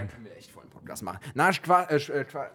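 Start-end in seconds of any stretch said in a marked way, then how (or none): 0.0-1.2 fast whispered aside. Real, not acted. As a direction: rush it out under your breath. Beiseite geflüstert. (schnell geflüstert)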